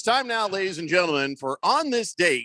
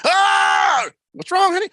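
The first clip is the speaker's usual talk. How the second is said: much higher and louder startled cover-up; fake innocent owner; 9 dB louder, slower than usual. Owner to wife after screaming, much higher loud fake innocence. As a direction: yelp it like a caught husband.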